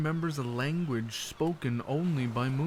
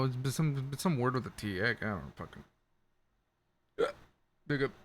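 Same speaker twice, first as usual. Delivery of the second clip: normal talking voice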